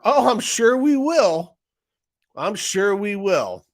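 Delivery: slow, high, knowing mock executive; smug CEO promise. CEO reassuring the congressman, slow and smug. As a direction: drawl it out with a wink. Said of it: "smugly smiling".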